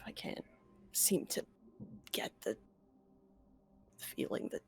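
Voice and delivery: whispered, halting, queasy; barely holding down nausea; hushed and shaken. Acted. Player in character, nauseated, hushed.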